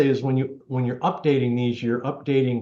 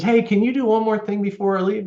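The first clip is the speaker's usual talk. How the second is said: a lightly voiced old man, higher and asking a favour; 9 semitones higher than usual. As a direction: ask like a hint of an eighty-year-old client. Elderly client asks a favour.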